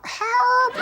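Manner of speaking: very high squeezed cartoon squeal; choking help voice. Comedian acting out choking on cake at party, high squeezed voice. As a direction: squeak for help through a blocked throat. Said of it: "and I was".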